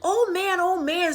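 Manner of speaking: high, loud urgent call; shouting. Acted. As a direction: shout to wake the old man. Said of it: "shouted Chang".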